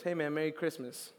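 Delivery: understated mock insult; deadpan gift giver. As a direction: hand over Q-tips with a straight face. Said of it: "what if I gave you Q-tips".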